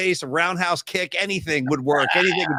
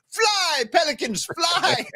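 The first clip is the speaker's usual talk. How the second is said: high, crying Pacino plea; Scarface Pacino line; 10 semitones higher, much slower than usual. Retold or imitated. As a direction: cry it up high like a sobbing Pacino. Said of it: "he goes".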